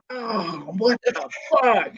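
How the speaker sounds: high, slow mock-shocked what the fuck; imitating a stunned reaction, drawn out and high; shocked outburst. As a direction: act out being stunned at the ripped hair. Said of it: "I'm like".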